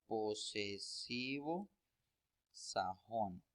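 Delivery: slow, each word set apart with a pause; slow spelled-out term. Shown as a demonstration. Teacher to students naming the grammar term, slow and deliberate. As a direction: lay out the term slowly for students to note. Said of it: "con el nombre de".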